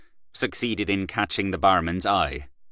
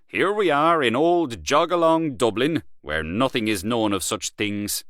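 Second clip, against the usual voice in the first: mocking old Dublin; 5 semitones higher than usual. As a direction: sneer at his friend's hometown.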